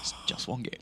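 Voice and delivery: hushed, whispered self-talk; muttering to himself. Acted. Caster imitating a player psyching himself up, whispered to himself. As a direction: whisper it under your breath, imitating a fixated player. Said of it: "He's still saying it to himself".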